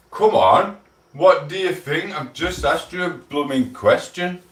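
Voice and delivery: high, loud demand for an answer from chat; impatient call. Real, not acted. Streamer to silent chat, loud impatient call.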